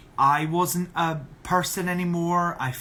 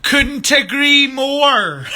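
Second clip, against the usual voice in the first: high, loud, drawn-out holler; big slow shout, pitched way up; emphatic agreement; 10 semitones higher, 10 dB louder, much slower than usual. Real, not acted. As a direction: bellow it slowly in total agreement. Emphatic agreement with a viewer comment.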